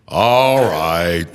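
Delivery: measured judicial drawl, lightly voiced; imitating the judge, lightly. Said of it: "it's like".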